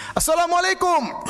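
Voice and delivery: high, loud salam called out to the statue; loud shouted greeting. Retold or imitated. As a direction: shout the greeting at the idol on purpose. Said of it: "Karena dia teriak keras". (salam diteriakkan keras dan tinggi)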